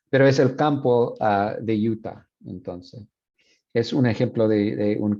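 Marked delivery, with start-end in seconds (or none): none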